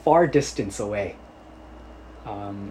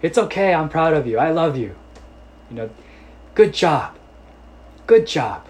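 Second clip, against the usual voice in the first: shouting praise; 5 semitones higher, 4 dB louder than usual. Retold or imitated. Father keeps shouting praise.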